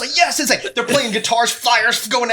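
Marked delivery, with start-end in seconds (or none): 0.1-0.4 thrilled high yell of yes; excited yes. Retold or imitated. Retelling the Olympics show to a friend, excited cheer. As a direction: cheer it out like a fan at a metal show.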